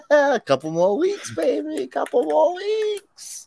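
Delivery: high-pitched singsong excitement; playful hype. Acted. Host hypes the coming horror weeks to co-host, high playful cheer. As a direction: climb high and stretch the last word.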